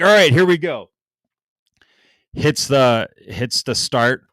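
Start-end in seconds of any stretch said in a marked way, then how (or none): 0.0-0.9 higher, louder mock hype; imitating a seller kicking off the draw; hyped announcer. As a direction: announce the spin with a bit of showman energy. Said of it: "And he's like".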